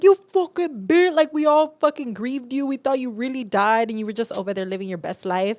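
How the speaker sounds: hammed-up betrayed family yelling; family's angry outburst. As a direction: rage at him like a betrayed relative. Family raging at faker, retold.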